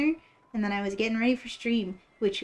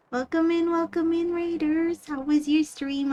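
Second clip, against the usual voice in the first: cute anime streamer; 7 semitones higher than usual. Acted. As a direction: squeal a sweet welcome to the raiders. Greeting raiders again.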